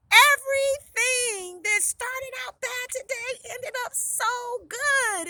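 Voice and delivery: loud, high, drawn-out exclamation; overjoyed. A narrator's reading. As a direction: marvel joyfully, stretching the words. Overjoyed at the marriage proposal.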